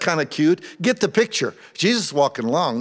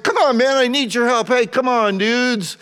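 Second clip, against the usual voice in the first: urging John; 12 semitones higher, 7 dB louder than usual. Acted. John urges disciples on.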